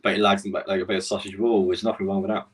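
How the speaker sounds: ordinary talk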